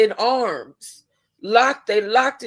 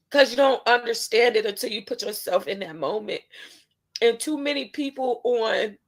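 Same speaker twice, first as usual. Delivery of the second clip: ordinary delivery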